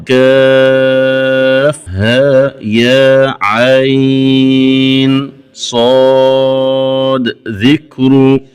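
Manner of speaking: letter names drawn out very long; clear recited letters. Shown as a demonstration. Teacher demonstrating the opening letters, very slow elongated recitation. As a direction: recite slowly with long held vowels, sounding each letter clearly. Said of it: "إظهارها وعدم إخفائها في صاد".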